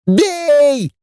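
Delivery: very high, squealed battle cry; high-pitched yell without much volume. A narrator's reading. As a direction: squeal the order to attack. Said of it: "завопила девица".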